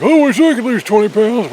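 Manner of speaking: high, loud whiny wish; mock wishful voice. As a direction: squeal it like an envious dieter. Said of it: "a lot of people will be like".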